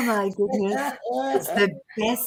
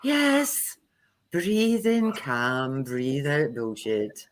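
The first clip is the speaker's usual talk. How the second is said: mock meditation; 5 semitones lower, much slower than usual. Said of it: "you could just sit there and go".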